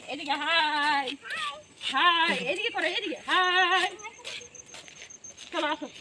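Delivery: high, coaxing call across the yard; calling Golu over. Real, not acted. Calling to Golu to come along, high coaxing call.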